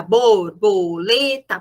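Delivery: slow, each syllable of "borboleta" split apart. Shown as a demonstration. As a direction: spell out "borboleta" in separate beats. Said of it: "O som que ela segmenta".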